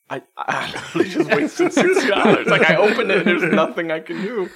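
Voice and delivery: laughing through the words. Real, not acted. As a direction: laugh it out in disbelief. Laughing at wasted money.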